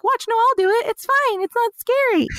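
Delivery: put-on high, sing-song reassurance to a child; coaxing parent voice. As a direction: soothe a scared toddler, high-pitched.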